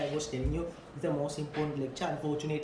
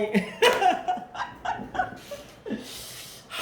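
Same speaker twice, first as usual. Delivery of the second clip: high breathy laugh; 13 semitones higher than usual. Real, not acted. Laugh after mock reply.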